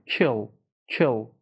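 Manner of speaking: "Kill" with a short vowel between EE and EH; teacher's right way to say "Kill"; correct short vowel. Shown as a demonstration. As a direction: keep the vowel of "Kill" short and lax, near kel. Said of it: "یه ای سری بین ای و".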